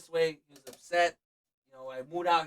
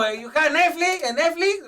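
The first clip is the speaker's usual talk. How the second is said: loud, high, slow mock dad; a hammed-up dad pestering; imitating his dad; 12 semitones higher, 10 dB louder, slower than usual.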